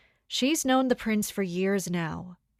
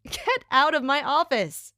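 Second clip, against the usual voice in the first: loud, higher mock outburst, half joking; mock order; 5 semitones higher, 4 dB louder than usual. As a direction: throw her out in playful exasperation. Playful mock outburst at teasing.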